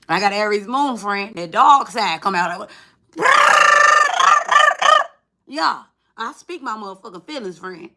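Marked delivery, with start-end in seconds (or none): 3.1-5.1 loud shrieking laugh. Real, not acted. Aries moon dog side comes out.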